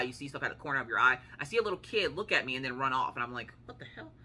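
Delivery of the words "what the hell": hushed, high-pitched "what the hell"; softly voiced startled reaction; startled aside. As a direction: say it under your breath, voice jumping up. Startled aside on spotting the child.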